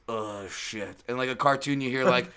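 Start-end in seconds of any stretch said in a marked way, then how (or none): 0.1-1.0 muted dismay. Retold or imitated. Coach spots the charging fighter.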